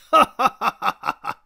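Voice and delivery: loud open-mouthed eerie laugh. Acted. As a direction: laugh creepily, wide open. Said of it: "気味の悪い大口を開けて".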